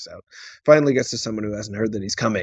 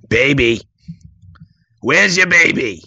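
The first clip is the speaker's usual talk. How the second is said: Willem Dafoe impression; 2 semitones higher, 6 dB louder, slower than usual. Dafoe stalking door to door for a baby, creepy coaxing. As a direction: lure the hidden baby out slowly. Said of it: "It's Willem Dafoe".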